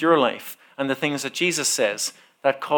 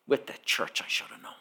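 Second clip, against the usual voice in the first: muttered whisper; 5 semitones lower, 15 dB quieter, faster than usual. Retold or imitated. Man's muttered line retold.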